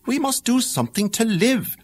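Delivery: a hint of the worried mother.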